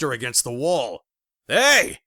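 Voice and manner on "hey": sudden loud holler. Acted. Neighbor shoving a man off his wife, loud holler. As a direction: shout him off her. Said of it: "I hollered".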